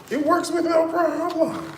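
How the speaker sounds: high, sarcastic squeal; mock disbelief at it working now; sarcastic high-pitched outburst; exasperated. Real, not acted. Exasperated about comments.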